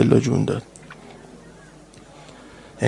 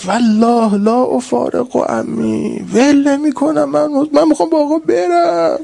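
lamenting cry; 12 semitones higher than usual. Acted. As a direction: cry it out, refusing to let go. Rowzeh climax, child's defiant cry. (فریاد سوزناک و بلند)

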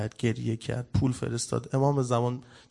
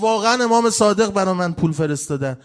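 high, loud retold cry of amazement; imitating someone overcome by unbelievable news; overwhelmed disbelief; 7 semitones higher, 8 dB louder than usual. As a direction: act out her astonished outburst at full voice. Retold woman, overwhelmed on reviving.

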